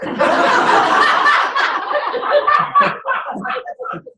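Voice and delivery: high delighted burst of laughter; big laugh. Real, not acted. Laughing at the monkey's escape, delighted. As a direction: laugh out loud at the memory.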